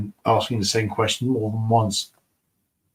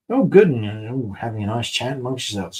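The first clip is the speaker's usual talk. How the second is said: usual speaking voice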